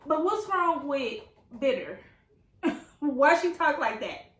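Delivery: high, loud, amused bafflement; baffled complaint. Real, not acted. Baffled reader asks author about Bitter, loud amused complaint. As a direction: demand an answer, half laughing.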